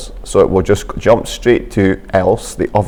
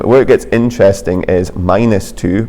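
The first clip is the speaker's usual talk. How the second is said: usual speaking voice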